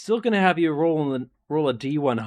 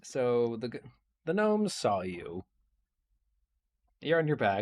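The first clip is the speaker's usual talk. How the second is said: ordinary talk, nothing marked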